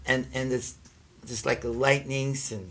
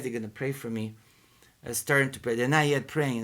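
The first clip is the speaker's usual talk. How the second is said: ordinary delivery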